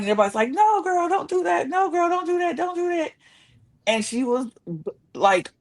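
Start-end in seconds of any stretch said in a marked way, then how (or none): 0.5-3.1 high-pitched mock begging; imitated pleading. Castmates begging a crying contestant not to quit, high pleading. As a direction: plead in a raised voice, imitating worried friends.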